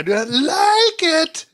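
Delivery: Lord Garth voice. Retold or imitated. Picturing Lord Garth saying the co-host's catchphrase, high and strained. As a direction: squeak it like a haughty villain. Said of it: "I picture Lord Garth".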